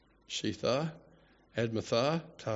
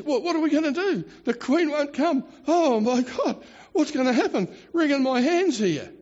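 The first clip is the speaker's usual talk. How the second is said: high, louder, hammed-up panic; mock freaking out; 9 semitones higher, 4 dB louder than usual. Adviser panics over the queen's refusal, mock frantic. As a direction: fret loudly, as if wringing your hands. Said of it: "Because he's freaking out".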